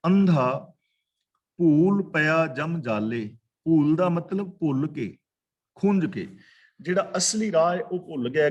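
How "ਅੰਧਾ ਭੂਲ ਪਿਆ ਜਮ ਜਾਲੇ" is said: ਅੰਧਾ set apart, then a short pause before ਭੂਲ ਪਿਆ ਜਮ ਜਾਲੇ; pause after ਅੰਧਾ. Shown as a demonstration. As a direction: hold a beat after ਅੰਧਾ, then slowly recite the rest. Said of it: "ਇੱਥੇ ਥੋੜ੍ਹਾ ਜਿਹਾ ਰੁਕਣਾ ਹੈ".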